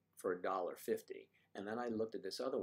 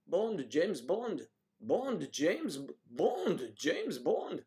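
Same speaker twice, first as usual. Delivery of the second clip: slow, higher, louder suave spy intro, drawn out; James Bond impression; 7 semitones higher, 7 dB louder, much slower than usual. Louder, slower spy voice introducing himself as Bond. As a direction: announce yourself like a movie spy. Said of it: "Mister Bond".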